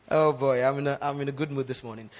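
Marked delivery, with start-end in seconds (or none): none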